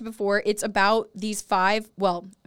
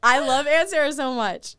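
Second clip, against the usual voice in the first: normal talking voice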